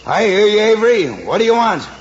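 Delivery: loud and high, calling outside; shouting back. Acted. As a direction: yell it out through the door. Shouting back through the door.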